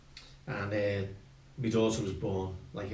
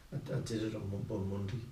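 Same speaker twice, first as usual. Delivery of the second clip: quiet, halting admission; retold sheepish confession; 8 dB quieter than usual. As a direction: stammer it out softly to mum.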